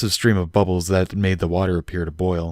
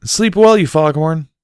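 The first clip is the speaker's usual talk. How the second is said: much higher, louder teasing call; playful loud call after her; 9 semitones higher, 6 dB louder than usual. A narrator's reading. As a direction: shout it down the hall with a grin. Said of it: "Barry called".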